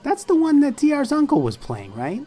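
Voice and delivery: put-on high, eager kid bragging; a boy bursting with news. Skit playing a kid.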